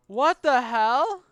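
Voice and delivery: ordinary talk, nothing marked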